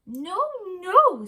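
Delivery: high, loud squeaky meerkat; a chirpy little meerkat answering his love. A narrator's reading. Meerkat Kevin to his wife, high and eager.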